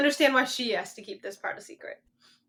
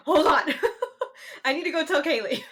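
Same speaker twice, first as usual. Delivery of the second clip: giggling mid-quote; laughing while retelling; laughing through words; 3 semitones higher, 4 dB louder than usual. Retold or imitated. Retelling running to tell friend.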